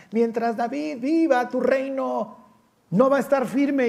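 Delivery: acted King Saul. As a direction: warn your son, slow and rising. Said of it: "le dice Saúl a su hijo Jonatán". (Saúl advirtiendo a su hijo Jonatán, lento y agudo)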